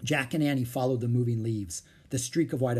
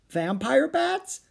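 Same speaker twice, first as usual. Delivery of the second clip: very high, slow, rising question; girlish alarm, drawn out and pitched way up; alarmed disbelief; 13 semitones higher, 4 dB louder, much slower than usual. Acted. Annie echoing Jack about the bats, alarmed.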